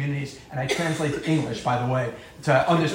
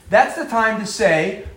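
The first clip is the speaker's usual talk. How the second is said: usual speaking voice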